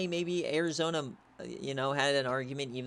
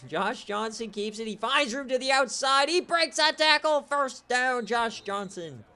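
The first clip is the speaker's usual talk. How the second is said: rising, louder call of the run; high, loud broadcaster call as the runner breaks free; excited play call; 8 semitones higher, 3 dB louder than usual. Real, not acted. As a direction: ride the run up to the first down. Calling a big run live.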